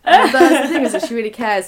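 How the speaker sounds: high, loud, laughing. Real, not acted. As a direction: laugh through the words. Laughing after the mum impression.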